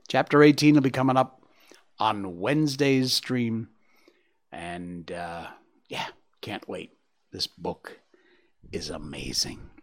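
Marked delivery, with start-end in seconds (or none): 4.5-9.7 whispered, lower and softer; soft aside. Real, not acted. Host closing the reading, whispered soft aside. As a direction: breathe it like a secret.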